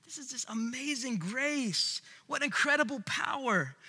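usual speaking voice

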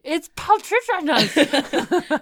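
high, loud, slow mock correction; English nerd. Imitating a word nerd correcting them, slow and loud. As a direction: ham up a pedantic nerd. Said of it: "some English nerd out there going".

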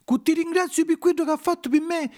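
put-on high-pitched grateful puppet boy; little puppet's high voice; puppet child. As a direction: pipe up high to thank your father. Pinuccio the puppet thanks his father.

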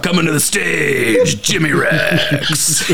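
high, slow club announcer drawl; stripper announcer voice. Retold or imitated. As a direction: announce him onto the stage. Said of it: "the stripper announcer's voice".

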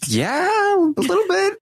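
high, crying-voiced mock whine; whiny mock upset. Houseguests answering Brandy, high mock crying whine. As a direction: squeak it out like a pouting houseguest.

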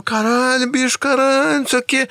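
high-pitched frantic swearing, hammed up; acted-out total despair. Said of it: "Aí, desespero total". (desespero encenado, em tom agudo)

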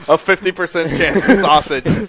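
laughing through the words; giggling out the joke. Real, not acted.

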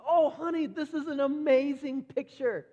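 put-on high, loud gushing praise for a child's drawing; dad gushing over his daughter's picture; delighted praise. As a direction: rave about it like a proud dad. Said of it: "I said".

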